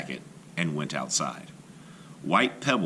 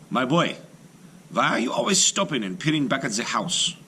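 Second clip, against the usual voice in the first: father's question; 6 semitones higher than usual. A narrator's reading. Father asks his son on the path, higher and gentle. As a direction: ask the boy kindly why he stops.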